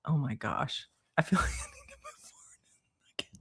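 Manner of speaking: whispered aside with a laugh; amused whisper. Real, not acted. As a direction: whisper it half to yourself. Aside while unsure of emoji.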